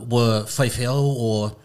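usual speaking voice

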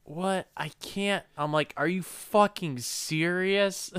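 exasperated disbelief. Retold or imitated. Exasperated at whispering actors.

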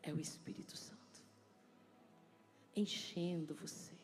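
soft, slow, hushed ministering voice; soft worship ministry. Acted. Pastor leading the congregation into worship, hushed and slow. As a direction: draw the church into quiet worship.

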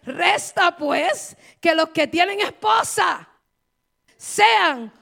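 loud, ringing pulpit delivery, slowing at the end; preaching at full force. Acted. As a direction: proclaim it to the congregation. Preacher quoting scripture loudly. (predicando a todo pulmón)

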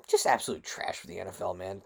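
a real whisper, fed up with the league; whispered disgust. Real, not acted. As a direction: hiss it under your breath. Ranting disgust at the NFL.

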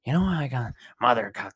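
a light, half-voiced Joker, not far from his own voice; hint of the Joker's creepy storytelling; Joker impression. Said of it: "doing my Joker impression".

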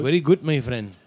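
friendly mock Indian-accented praise. Shown as a demonstration. Praising a friend in the demo, Indian accent. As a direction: say it Indian-style, V as W. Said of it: "V's or W's".

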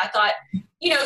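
ordinary talk

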